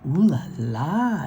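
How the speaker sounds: slow, drawn-out French "Ooh la la"; playful French exclamation; French flair. A narrator's reading. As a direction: savor "Ooh la la" like a Parisian. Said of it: "That's fancy for".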